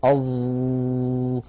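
"أظ" drawn out very slowly on a buzzing ظ; stretched ẓa. Shown as a demonstration. Teacher proving the letter stretches, "أظ" long and continuous. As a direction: prolong the ظ in "أظ" on a level pitch. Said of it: "حرف قابل للمط".

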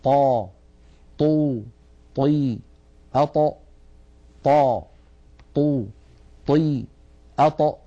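"طا، طو، طي، أط" one by one, no puff after ط; breath held ṭa. Shown as a demonstration. Teacher drilling careful listeners, "طا، طو، طي" with breath held. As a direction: separate drill syllables, each ط clean of air. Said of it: "اسمعوني جيدا وتأملوا الصوت".

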